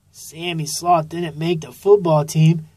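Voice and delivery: ordinary delivery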